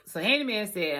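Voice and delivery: normal talking voice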